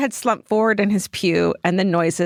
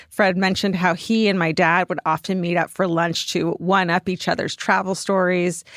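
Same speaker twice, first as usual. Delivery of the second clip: ordinary delivery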